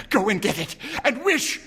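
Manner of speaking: high, loud urgent cry; excited cry. A narrator's reading. Wife quivering with excitement, ordering her husband. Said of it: "cried the old woman".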